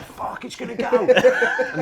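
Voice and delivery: laughing. Retold or imitated. Retelling panic behind skip.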